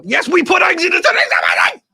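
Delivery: high, loud, garbled Muppet yelling; Muppet-like outburst. Real, not acted. Guest insisting on eggs on pizza, Muppet-like yell. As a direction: yell it high until the words turn to gibberish. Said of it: "just sounded very Muppet".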